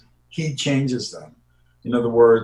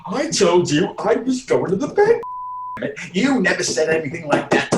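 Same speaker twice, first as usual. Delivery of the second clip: higher, heated, animated bickering; lively argument; 8 semitones higher than usual. Shown as a demonstration. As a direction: play it like a real spat. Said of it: "something more fun because you're improvising".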